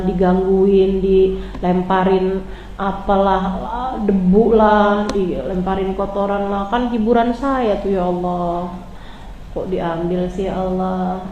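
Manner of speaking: soft, low, aching lament to Allah; mournful plea to God. Acted. Prophet laments to Allah, soft and grieving. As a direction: pour out grief quietly.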